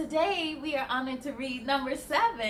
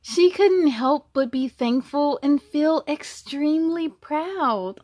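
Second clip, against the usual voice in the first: louder, lively storybook narration; reading to children; 2 semitones higher, 6 dB louder, slower than usual. A narrator's reading. Reading the zebra story to kids, lively and louder. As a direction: tell it brightly to the little ones. Reads the picture book aloud.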